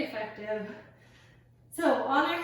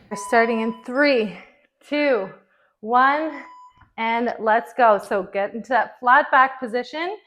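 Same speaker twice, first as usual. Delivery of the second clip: loud, pumped-up trainer cues; workout coach calling; 2 semitones lower, 11 dB louder than usual. Acted. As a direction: call the reps over the music. Calls out the workout over music.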